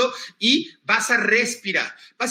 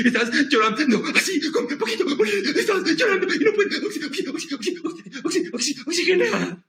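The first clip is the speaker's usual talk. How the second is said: high, choked mock sobbing; hammed-up teary stutter on "oxi"; mock crying; 8 semitones higher than usual. Shown as a demonstration. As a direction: act out crying with short gasping breaths. Demonstrating crying breath.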